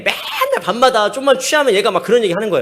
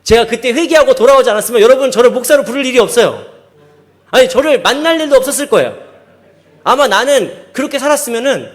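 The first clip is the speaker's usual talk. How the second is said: loud, raised testimony to the congregation; emphatic preaching; 6 semitones higher, 8 dB louder than usual. Real, not acted. Preacher tells congregation he'd never be pastor otherwise, emphatic. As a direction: drive it home at full preaching force.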